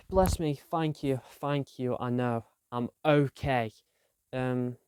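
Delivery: ordinary talk, nothing marked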